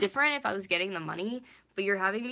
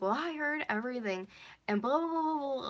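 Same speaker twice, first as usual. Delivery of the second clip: high sing-song mocking of the manager; 8 semitones higher than usual. Venting about her boss, she mimics her scolding in a high voice.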